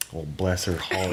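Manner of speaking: slow, softened Southern "bless her heart"; mock Southern sympathy. Host imitating Southern woman overhearing gossip, slow feigned sympathy. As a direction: draw it out softly with Southern false pity. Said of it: "you just turn around and you said".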